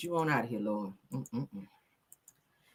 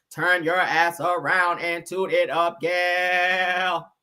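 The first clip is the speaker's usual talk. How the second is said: slow, flat robot voice talking aggressively; robotic Martian; 3 semitones lower, much slower than usual. Retold or imitated. Host mocking a Martian robot voice, slow and robotic. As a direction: drag each word out like a bossy alien machine. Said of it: "Real robotic and shit".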